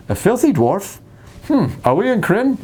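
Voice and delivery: a know-it-all player perking up at a hint; nerdy player voice. Retold or imitated. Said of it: "the first player saying".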